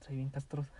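usual speaking voice

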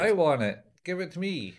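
high-pitched greedy plea; put-on high voice, insisting; mock demanding. Acting a demand for the hot dog.

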